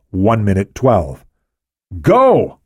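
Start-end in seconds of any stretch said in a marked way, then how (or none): 2.0-2.6 sharp high yell. A narrator's reading. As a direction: bark the order out. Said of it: "Cabrillo yelled".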